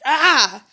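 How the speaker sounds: loud, high frustrated yell; re-enacted burst of exasperation; short loud "ah" of mock rage; retold mad yell. Acts out own mad yell.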